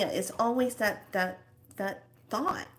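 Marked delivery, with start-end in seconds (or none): none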